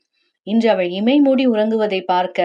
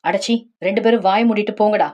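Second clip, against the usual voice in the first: usual speaking voice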